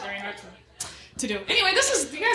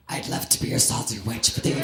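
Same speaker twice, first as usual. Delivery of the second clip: much lower, gruff put-on voice; mock deep voice. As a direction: drop way down to scare the guy off. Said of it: "turn to 'em and go".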